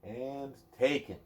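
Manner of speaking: lilting bedtime-story read-aloud; reading to a child. Acted. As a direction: read it gently to a little one at bedtime. Reading a picture book aloud.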